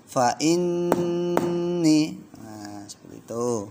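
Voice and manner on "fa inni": fa inni, nn ditahan lama dan tinggi; held gunnah. Shown as a demonstration. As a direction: tahan nn di inni dua ketukan. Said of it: "Yo, satu, dua, tiga". (fa inni dengan dengung panjang)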